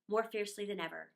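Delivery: plain reading voice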